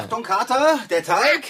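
quick high call, rising on Kater and Teig; calling out a warning to Kater over the dough. Acted. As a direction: alert him before he hits the dough. Warning cat about the dough.

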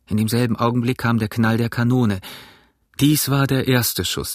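usual speaking voice

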